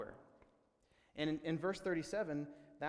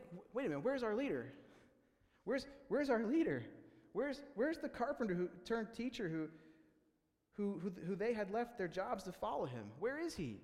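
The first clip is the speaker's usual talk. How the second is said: panicked disciples; 7 semitones higher than usual. Retold or imitated.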